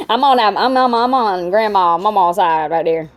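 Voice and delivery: ordinary talk, nothing marked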